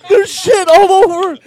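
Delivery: high, loud shriek of disgust; screaming in disgust. Retold or imitated. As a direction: scream it like she can't believe the mess. Girlfriend screaming in story.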